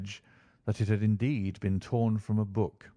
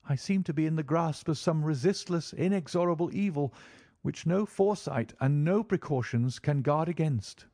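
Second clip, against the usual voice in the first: slightly raised, earnest and helpless; lightly voiced despairing young man; 7 semitones higher than usual. A narrator's reading. Client admits to Holmes he feels helpless, mild despair. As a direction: let a hint of dread sit under it.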